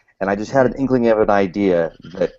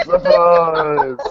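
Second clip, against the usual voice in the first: monster surprise shout; 7 semitones higher, 3 dB louder than usual. Retold or imitated. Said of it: "and they're like".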